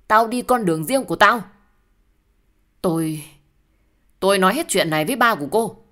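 loud, high, heated voice; angry declaration. Acted. Breaking away from the partner in a quarrel, angry.

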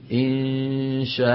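إنشاء read slowly, dropping the final n; slow pause form. Shown as a demonstration. Teacher showing the class the pause on the word, slowly. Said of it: "كيف نوقف عليها؟".

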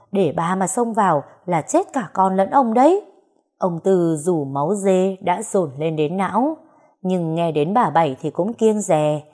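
ordinary delivery

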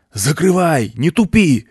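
high yelled orders; yelling. A narrator's reading. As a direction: yell it at a slow partner. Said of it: "орал неизвестный спаситель".

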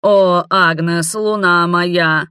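slow hoarse dying groan; hammed-up defeated beast. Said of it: "прохрипел он".

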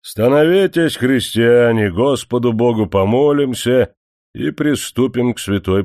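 stern pious command, pitched high; stern call. Acted. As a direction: raise the voice, sternly summoning the workers. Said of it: "строго прикрикнул на них".

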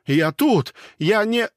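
high, loud desperate insistence; frightened high protest; panicked protest. A narrator's reading. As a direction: refuse to believe it. Disbelieving panic.

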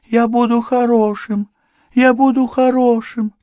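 very high, loud wailing promise; moaning. A narrator's reading. Madman crawls upstairs promising, high loud moan. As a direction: wail it at the top of the voice. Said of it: "постановая".

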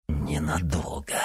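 slow whispered hatred; hateful whisper. Acted. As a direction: breathe it out with loathing. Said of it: "с ненавистью повторил Никита".

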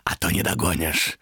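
high, drawn-out croak, dropping at the end; urging demon rasp. A narrator's reading. Demon urges Томас after the old man, croaking. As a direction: hurry him along in a raspy creature voice. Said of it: "прохрипел демон".

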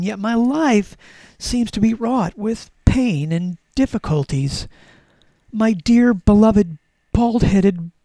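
mock-tragic, heavily emphasised read-aloud; melodramatic diary reading. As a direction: lay on the sorrow like a tragic final entry. Reads final diary entry, mock tragic.

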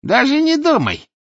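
very high, loud stern warning; stern little man. Acted. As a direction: lecture her sharply. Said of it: "строго сказал". (строгий плюгавый мужичонка)

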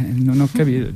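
normal talking voice

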